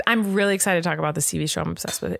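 ordinary delivery

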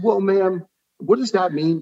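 high-pitched, taken-aback retold reply; affronted. Patient answers doctor's drinking question, affronted. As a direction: push back with a high, protesting question.

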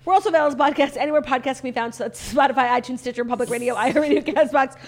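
fast, upbeat host sign-off; show outro. Acted. Podcast outro read.

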